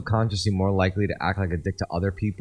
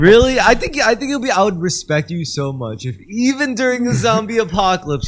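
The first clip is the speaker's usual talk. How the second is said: laughing excitement; 12 semitones higher, 6 dB louder than usual. Real, not acted.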